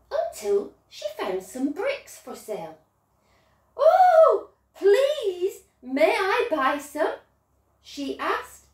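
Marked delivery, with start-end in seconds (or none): 3.7-7.1 high, bright pleading piglet with a laugh; eager little pig. Acted. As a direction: squeal out a hopeful request. Third pig asks, high laugh.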